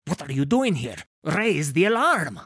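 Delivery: loud, rising character shout; alarmed order. Acted. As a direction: build to an urgent shouted command. Man orders alarm raised.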